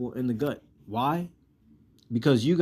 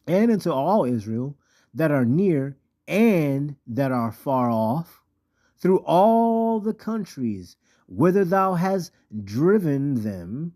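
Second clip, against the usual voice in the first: slow, drawn-out preaching cadence; scripture reading; 3 semitones higher, 4 dB louder, slower than usual. Acted. Preacher reading of scattered Israel, slow emphatic proclamation. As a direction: stretch each phrase and punch the key words.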